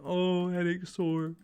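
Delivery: hammed-up poor-me groaning; mock whinging. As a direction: whinge for sympathy, imitating yourself. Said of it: "whinge all day".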